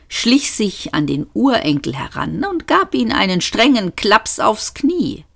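ordinary delivery, nothing marked

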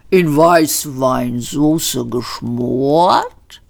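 langsam, gedehnte Rinderstimme; höfliches Rind. A narrator's reading. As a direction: ein freundliches Schlachttier, das sich anbietet. Das Tier preist sich an. (das Hauptgericht stellt sich den Gästen gemächlich vor)

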